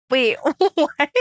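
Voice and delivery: high-pitched incredulous reaction; stunned gossip reaction, pitch leaping up; real surprise. Real, not acted. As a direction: blurt it out, amused and astonished. Startled by the gossip.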